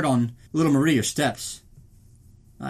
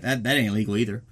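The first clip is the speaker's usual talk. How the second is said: usual speaking voice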